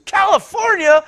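high, loud, drawn-out disbelief; imitating a man amazed by the distance; astonished exclamation. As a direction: exclaim it way up high, stretching the words. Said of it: "like that".

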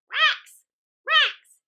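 loud high call of a name. Acted. Crow hailing Rex at the stile, loud calling.

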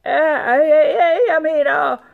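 high, loud flustered stammer; nervous stammering. A narrator's reading. Rat answering the fearsome sultan, stammering nervously. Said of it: "stammede rotten nervøs".

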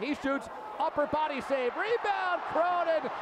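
excited call. Real, not acted. Save and rebound call.

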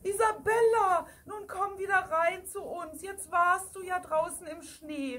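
high, anxious calling out; somewhat frightened call. Acted. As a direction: call her back, worried. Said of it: "rief die Kuh am Salzstein etwas verängstigt".

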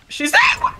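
very high startled shriek; piercing fright at a sighting; panicked scream. Real, not acted. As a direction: scream it in sudden terror. Monster suddenly appears in game.

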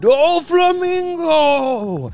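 high, drawn-out battle scream; screaming a name. Acted. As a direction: stretch the name into a long, high yell. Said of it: "someone screaming your name behind you".

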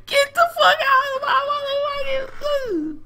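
very high strained screech; long, squealing freak-out yell; mock rage. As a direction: shriek it out in hammed-up fury. Mock rage at denied traveler.

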